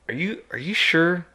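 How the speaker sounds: high-pitched, incredulous questioning; retold doubtful question. Hunter asks woman who saw a bear, doubtful questioning.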